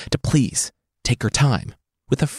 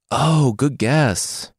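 dry sarcasm; 4 semitones higher, much slower than usual. Acted.

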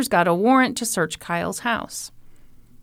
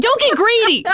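mock scolding; 12 semitones higher, 4 dB louder than usual.

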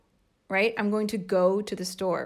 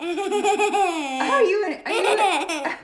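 laughing; 11 semitones higher, 4 dB louder, slower than usual. Real, not acted. Mother laughing while coaching.

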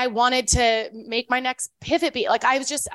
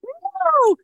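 high-pitched, loud, hammed-up disbelief; exaggerated shocked protest, voice shooting way up; very animated mom; 5 dB louder, slower than usual. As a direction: mimic an animated mom shrieking in alarm, not angry. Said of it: "she's very animated".

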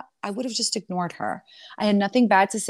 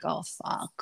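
whispered curse; 4 semitones lower, 7 dB quieter than usual. Retold or imitated. Inner reaction on seeing them.

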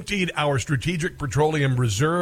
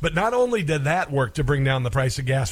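ordinary talk, nothing marked

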